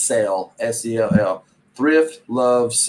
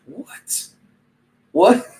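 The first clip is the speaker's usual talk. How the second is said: baffled disbelief; 7 semitones higher than usual. Retold or imitated. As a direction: imitate a stunned listener, voice jumping up. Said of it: "the look on their face would just be like".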